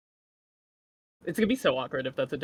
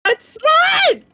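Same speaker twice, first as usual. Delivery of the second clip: high, loud, squealing disbelief; shrieking at a friend who pranked her soundboard; excited yell; 11 dB louder than usual. Real, not acted. Reacting to clip loudly.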